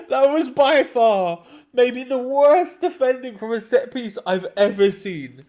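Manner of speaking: outraged at the defending; incredulous rant. Real, not acted. Ranting about awful defending.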